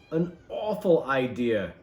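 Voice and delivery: usual speaking voice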